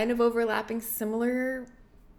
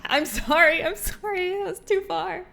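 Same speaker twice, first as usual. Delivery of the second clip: giggly apology; 7 semitones higher, 5 dB louder than usual. Real, not acted. Apologizing laughing for a joke.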